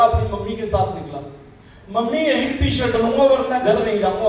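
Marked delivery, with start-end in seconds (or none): 2.0-4.3 put-on higher-pitched insisting kid; stubborn child. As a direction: demand it like an obstinate child. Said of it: "बच्चे तो जिद्दी होते हैं". (ज़िद्दी बच्चे की नकल)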